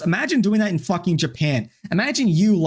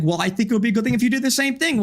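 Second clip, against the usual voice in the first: mocking imitation; 6 semitones higher, much faster than usual. Mocking the opponent's argument again.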